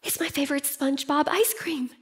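put-on soft, fast, breathless whisper of a delighted kid; a child whispering in awe at the ice cream truck; thrilled child. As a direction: hush it like a kid spotting a treat. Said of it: "they're like".

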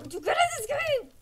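high, fast squeal of bragging; giddy, high-pitched gloating; excited boast. Real, not acted. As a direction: brag in a rushed high voice.

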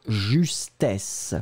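justesse with a long hissing double S; strong double S. Shown as a demonstration. Teacher stressing the double S of justesse. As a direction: lean hard on the double S of justesse. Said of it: "Et le double S, vraiment très, très fort".

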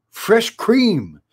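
high cry of fresh cream; squeaky exclamation. A narrator's reading. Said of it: "he squeaked".

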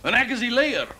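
high, loud self-important claim; proud declaration. Acted. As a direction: proclaim your leadership. Leader proudly declares himself.

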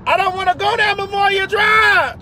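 high yelling at traffic; high shout at the police. Real, not acted.

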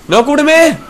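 panicked high shout; cover me shout. Real, not acted. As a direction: yell for cover, furious. Teammates fail to cover him.

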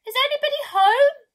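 loud, high call up to the windows. Acted. Narrator calling up at an empty house, loud and high. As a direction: shout it up to an upper window. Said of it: "called out".

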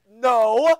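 a stern mother's loud no; firm loud no. Retold or imitated. Mom refuses son going out, loud firm no. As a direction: scold like a mom refusing her twelve-year-old. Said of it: "Your mom said".

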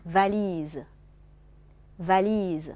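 "valise" drawn out on the i before the final s; long final vowel. Shown as a demonstration. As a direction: slowly model "valise" with a lengthened li. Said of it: "那么valise也是一样的". (valise末音节元音读长音)

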